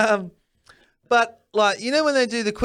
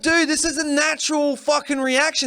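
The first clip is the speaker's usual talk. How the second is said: mock protest; 7 semitones higher than usual. Arguing with the cops.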